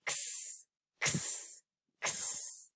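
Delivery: X said as a whispered unvoiced ks; letter sound x. Shown as a demonstration. Teacher models the X letter sound, whispered ks. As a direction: breathe out a soft ks for X. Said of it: "Xavier Fox".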